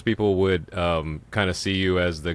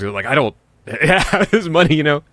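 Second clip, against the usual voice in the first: laughing; 7 semitones higher, 6 dB louder than usual. Real, not acted. Laughing about spending too much.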